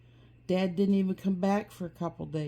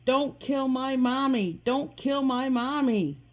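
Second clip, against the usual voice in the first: put-on higher-pitched child's cry in narration; yelling plea; 7 semitones higher, 4 dB louder than usual. A narrator's reading. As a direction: yell it as a desperate plea.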